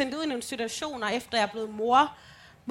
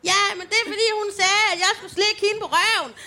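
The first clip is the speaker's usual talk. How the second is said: put-on child's excuse; 8 semitones higher, 5 dB louder, faster than usual. Child answering mother.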